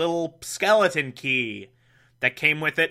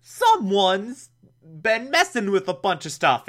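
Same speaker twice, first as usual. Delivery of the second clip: lightly voiced police chief, pitched up; Chief O'Hara; 5 semitones higher than usual. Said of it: "Chief O'Hara's like".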